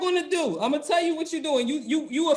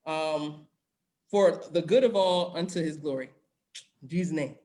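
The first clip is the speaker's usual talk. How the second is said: prayer; 4 semitones lower, 3 dB quieter than usual. Acted. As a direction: pray it quietly and earnestly. Leads a New Year prayer.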